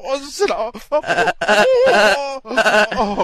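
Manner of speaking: high, hammed-up moans of pleasure; comic ecstatic oohing; mock moaning. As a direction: moans rising high, as if overcome. Mock pleasure at goat sounds.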